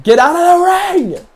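high, slow shouted order; yelling. Real, not acted. Gamer yelling at the wrestler to leave, loud. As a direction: yell it at the screen.

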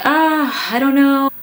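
high, light mock-clueless "ah, I don't know"; playfully imitating her past self's evasive answer; shrugging reply. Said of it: "And I was just like".

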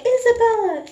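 put-on dad's Honduran accent, high and loud; mock an angry dad calling out in a Honduran accent. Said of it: "in his Honduran accent".

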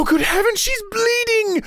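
ordinary talk, nothing marked

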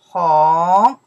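红 very loud and long, sweeping upward; teacher's emphatic rising 红; second tone. Shown as a demonstration. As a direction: stretch 红 into a big rising glide. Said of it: "The second tone".

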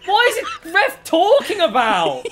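loud, high frustrated rant at the ref; raging gamer. Real, not acted. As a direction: shout your outrage at the game. Gamer rages at the referee.

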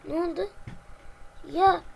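usual speaking voice

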